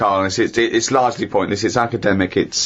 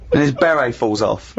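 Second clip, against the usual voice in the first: usual speaking voice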